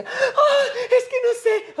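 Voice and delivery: mock a flustered fan, giggling; giddy fan. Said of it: "no sería una de las personas que". (fan nerviosa y risueña, mucho más aguda)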